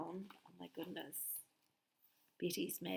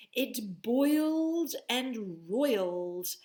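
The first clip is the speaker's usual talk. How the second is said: dramatic slow narration; 3 semitones higher, 7 dB louder, much slower than usual. A narrator's reading.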